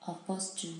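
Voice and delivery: ordinary delivery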